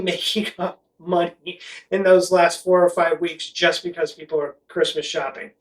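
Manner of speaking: normal talking voice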